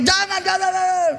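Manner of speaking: high-pitched jangan jangan jangan; husband's pleading no. Retold or imitated. Husband scolding wife again.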